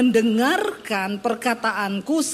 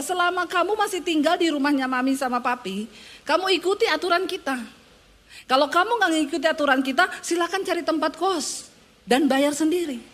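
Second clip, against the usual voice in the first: raised, firm laying down the house rules; stern parent ultimatum; 6 semitones higher than usual. Retold or imitated. Parent telling the child to follow rules or move out, raised and firm. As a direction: lay down the law to your kid. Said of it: "saya bilang sama anak saya".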